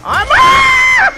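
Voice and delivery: high piercing scream cut short. Real, not acted. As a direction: shriek as she catches you. Caught by the animatronic.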